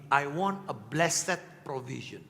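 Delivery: a slow, earnest prayer to God; slow model prayer. Shown as a demonstration. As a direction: pray it slowly, weighing every word. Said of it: "Change your prayer".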